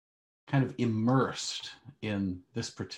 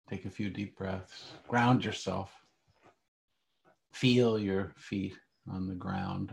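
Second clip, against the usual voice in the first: calm grounding exercise; 4 dB quieter than usual. Acted. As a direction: guide them gently into stillness. Leads a guided meditation.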